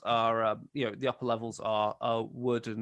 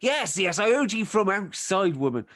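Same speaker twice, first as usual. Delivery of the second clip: higher, louder fed-up husband; exasperated farmer; 9 semitones higher, 5 dB louder than usual. Acted. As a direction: snap back at the nagging wife. Husband snaps at wife.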